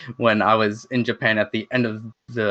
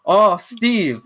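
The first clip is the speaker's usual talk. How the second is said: higher-pitched mock call-out; imitated exclamation, pitched up; retold calling out; 8 semitones higher, 4 dB louder than usual. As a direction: call a name aloud, imitating the idol. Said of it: "like, out loud".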